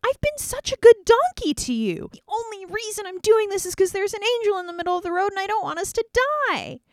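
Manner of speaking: high, whiny donkey complaint; pleading donkey. Acted. The loyal donkey pleads its case to Balaam, high and whiny. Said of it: "And the donkey's like".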